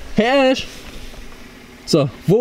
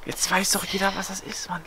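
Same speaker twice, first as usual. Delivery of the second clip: whispering; 11 dB quieter than usual. Real, not acted. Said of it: "Leise, psst".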